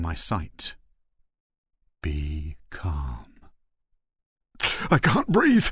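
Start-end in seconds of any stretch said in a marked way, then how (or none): 2.0-3.3 low, measured, calming command; calm inner voice. A narrator's reading. Inner voice steadies a panicking man, calm and authoritative. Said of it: "comfort in this inner voice, cold authority". 4.9-5.7 high, loud, breathless panic; panicked gasp. A narrator's reading. Panicking man answers his inner voice, gasping. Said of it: "I gasp".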